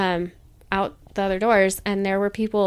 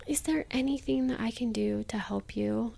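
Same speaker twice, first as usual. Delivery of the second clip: gentle whisper, no voice; a kind stranger whispers an offer to help a struggling mom; 8 dB quieter than usual. Retold or imitated. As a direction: whisper it kindly like a stranger helping.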